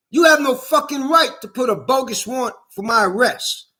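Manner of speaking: normal talking voice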